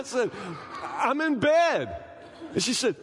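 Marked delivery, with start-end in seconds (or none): 0.8-2.0 laughing reply. Retold or imitated. Husband answering while laughing.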